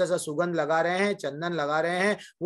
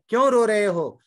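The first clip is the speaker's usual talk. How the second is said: calling out; 6 semitones higher, 8 dB louder than usual. Acted. Man asks the stones loudly.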